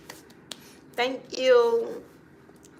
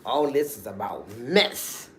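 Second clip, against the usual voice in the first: imitating a lower, tired "Oh, Lord"; weary groan of regret; 11 semitones lower, slower than usual. Mocking the helper who regrets getting involved, lower and weary. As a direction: sigh it out like someone caught in a mess. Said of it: "and you be like".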